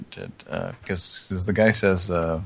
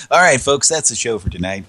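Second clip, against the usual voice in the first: brassy emcee wrap-up, louder; show host sign-off; 4 dB louder than usual. Acted. Host wrapping the comedy show for listeners. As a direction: close the show like a showman.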